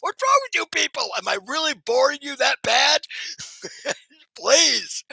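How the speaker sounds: high, playful fake whine at the chat; mock exasperation. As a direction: tease the audience with pretend hurt. Mock complaint at quiet chat.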